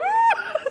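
very high giddy laughing squeal; excited squeal. Retold or imitated. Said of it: "so excited at this point".

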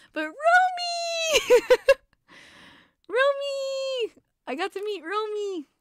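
very high, loud, stretched squeal; squealing about meeting her favorite player; fangirl squeal. Real, not acted. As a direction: squeal the name like a thrilled fan.

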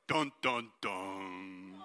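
low, slow dramatic sting sung out and fading; ominous dun dun dun. Shown as a demonstration. Teacher showing kids the Jericho sound, low ominous sting. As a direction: growl it like a movie villain's theme. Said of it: "when we say Jericho, we say".

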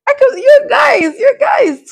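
high, loud, drawn-out excited cry; excited high-pitched yell; real excitement. Real, not acted. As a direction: burst out to your viewers, overexcited. Overexcited about the new group member.

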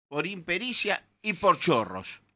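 normal talking voice

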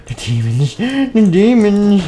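second cry slow, high and loud; crazed cry of dread; demon-haunted raving. Acted. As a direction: build to a stretched wail. Scared character raving about demons.

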